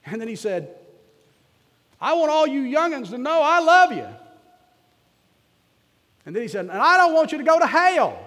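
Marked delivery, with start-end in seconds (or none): 2.0-4.1 high, loud country preacher's cry; preaching shout. Retold or imitated. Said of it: "I don't suppose I should preach like that here". 6.7-8.2 high, loud mountain preacher's cry on hell; preaching shout. Retold or imitated. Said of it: "And that's how he said it".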